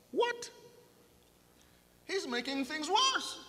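high, startled outcry; shocked disbelief. Acted. Listener stunned by Jesus' warning, high shocked outcry. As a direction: gasp out a high What?.

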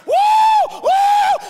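very high, loud whoop; full holler "woo". Acted. City slicker finally hollers at oncoming cattle, full whoop. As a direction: let loose a long whooping holler. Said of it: "That's hollering right there".

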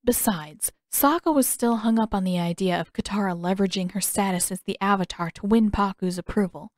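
ordinary delivery

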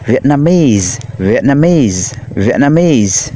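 "Vietnamese" drawn out, falling at the end; model pronunciation. Shown as a demonstration. Teacher has pupils read after him, drawn-out model pronunciation. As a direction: say "Vietnamese" slowly for pupils to repeat. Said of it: "Rồi chúng ta đọc lại ha".

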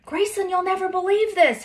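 high, loud excited call; a climber calling out her find. A narrator's reading. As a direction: call down, thrilled. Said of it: "Haley called".

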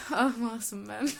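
laugh-crying, slow; tearful laughing at herself; crying with laughter. Real, not acted. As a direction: laugh till it turns to tears. Laughing at her old innocence.

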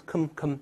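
"com-" softly said as a short "kəm"; weak syllable. Shown as a demonstration. Teacher isolating the unstressed prefix, weak syllable. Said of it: "轻读时你看是".

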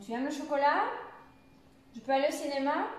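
questions rising on CHOCOLAT and CINÉMA; interrogative intonation. Shown as a demonstration. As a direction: let "chocolat" and "cinéma" climb right up at the end. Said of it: "elle fait comme un crescendo".

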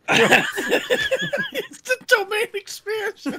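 high, slow, laughing words; real giggles over the words. Real, not acted. Laughing through gag.

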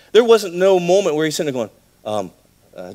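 ordinary talk

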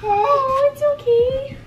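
very high, cooing reassurance. Real, not acted. Soothing the fussing baby.

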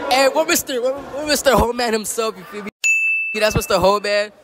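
ordinary talk

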